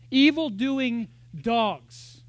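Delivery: each word dragged out and punched loud; Evil-doing dogs, slow and hammered; slow heavy emphasis. Acted. Preacher quoting Paul's warning, slow and punched.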